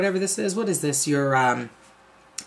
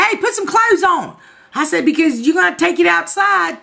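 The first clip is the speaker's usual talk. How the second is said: frantic orders; 8 semitones higher than usual. Retold or imitated. Ordering naked husband out.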